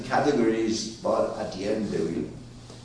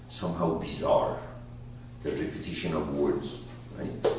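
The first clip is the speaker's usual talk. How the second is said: usual speaking voice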